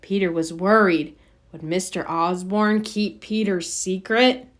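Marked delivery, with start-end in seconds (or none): none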